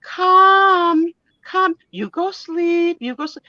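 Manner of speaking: slow, mock-Russian accent telling the patient to sleep; imitating Russian anesthesiologist. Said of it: "So she's like".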